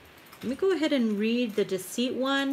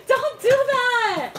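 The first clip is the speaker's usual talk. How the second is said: laughing scold; 7 dB louder, much slower than usual. Real, not acted. Husband just startled her.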